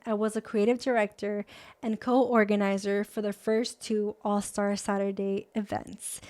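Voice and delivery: ordinary talk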